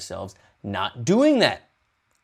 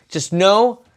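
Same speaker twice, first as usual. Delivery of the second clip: much louder and higher, pleading; urgent plea; 9 semitones higher, 9 dB louder than usual. Real, not acted. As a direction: call it out to the viewers. Urging viewers to love Mark.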